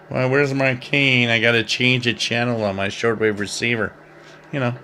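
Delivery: ordinary talk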